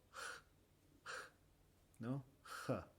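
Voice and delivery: voiceless "j" said as English h; soft aspirated h; breathy h sound. Shown as a demonstration. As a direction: push out a light breath for "J". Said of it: "el sonido".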